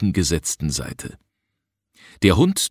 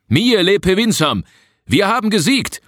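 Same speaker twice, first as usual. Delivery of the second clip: high, triumphant shout across a distance; calling back; 13 semitones higher, 4 dB louder than usual. A narrator's reading. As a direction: call the news back over the clearing, much higher. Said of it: "antwortete der Gefragte herüber". (hoch und laut herüberrufend)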